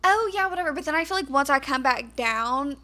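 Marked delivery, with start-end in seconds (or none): 0.0-0.7 bright high "Oh, yeah" pitched up and louder; talking up. Retold or imitated. Showing friend how she talks up, high and cheery. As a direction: lift the voice up high, extra cheery. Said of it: "I'll be like".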